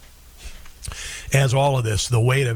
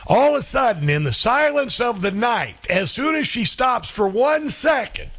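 excited build-up; 9 semitones higher than usual. Real, not acted. Building to the funny part.

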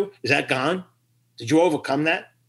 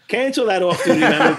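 laughing radio call; 4 semitones higher, 5 dB louder than usual. Retold or imitated. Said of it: "he goes".

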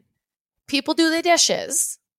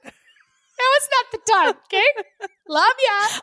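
much higher, singsong dismissal; mock-sweet brush-off; 10 semitones higher, slower than usual. Wife shooing husband out mid-workout, mock-sweet and high. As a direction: shoo them off in a high put-on voice.

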